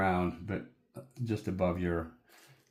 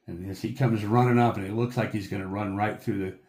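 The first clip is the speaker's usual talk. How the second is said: usual speaking voice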